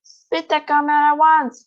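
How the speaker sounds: loud stern command; order. A narrator's reading. Father orders daughter to spit out gum, loud and stern. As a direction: order her sharply. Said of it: "ordered Mister Beauregard".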